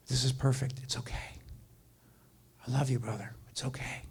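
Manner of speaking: soft comforting whisper; hushed, tender whisper. Retold or imitated. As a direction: whisper reassurance to a crying man. Comforting a sobbing client.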